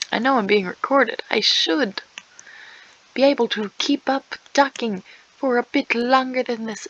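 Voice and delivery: ordinary talk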